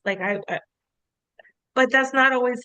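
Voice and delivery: ordinary talk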